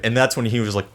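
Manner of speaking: ordinary talk, nothing marked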